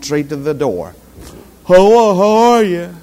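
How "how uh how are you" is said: lightly mocked greeter. Imitating sleepy church greeter.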